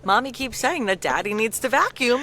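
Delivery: put-on high, loud, eager child voice; little kid parroting mom. Imagined son repeating his mom's passive-aggressive comments, as an eager kid. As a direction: pipe up as a dutiful little boy. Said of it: "picks up on these passive-aggressive comments".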